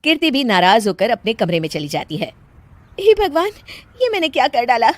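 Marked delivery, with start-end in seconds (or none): 3.0-4.9 dismayed regret. Acted. Regrets having laughed at guests.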